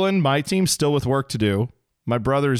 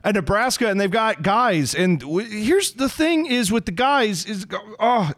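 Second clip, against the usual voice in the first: higher, halting, lost for words; mock yourself losing the thread; lightly imitating his own on-air stumble; flustered rambling; 8 semitones higher than usual. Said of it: "it's just me going".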